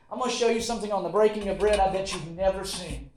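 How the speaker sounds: usual speaking voice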